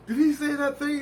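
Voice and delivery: high-pitched, excited asking; acted freaked-out question. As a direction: ask it in a worked-up high voice. Acting out his freaked-out question.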